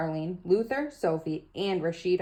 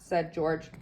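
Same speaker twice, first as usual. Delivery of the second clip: ordinary delivery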